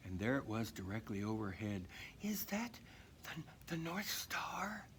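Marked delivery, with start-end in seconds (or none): 2.2-4.9 hushed, breathless whisper; awed whisper. Acted. Dasher seeing the North Star overhead, whispered in awe. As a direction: whisper in disbelief. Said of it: "she asked".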